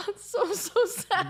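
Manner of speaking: crying with laughter, high and slowed; laughing to tears. Real, not acted. Cohost mocking his missed day, laughing to tears. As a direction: choke out the words through helpless laughter. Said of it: "yeah, you sound it".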